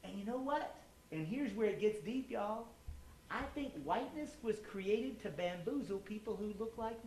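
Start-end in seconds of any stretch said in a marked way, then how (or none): none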